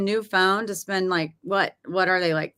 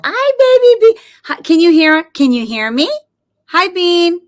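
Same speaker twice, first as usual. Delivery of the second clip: pet-calling voice; 9 semitones higher, 9 dB louder than usual. Real, not acted. Calling to Bean the dog in a high, loud pet voice. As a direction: squeal it up high to get the dog's attention. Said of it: "I gotta do that with the voice".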